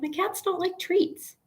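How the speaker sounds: high, soft playful retort; high squeaky answer to her friend's teasing; quoting herself. Retold or imitated. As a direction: pop way up in pitch for her own comeback. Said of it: "I'm like".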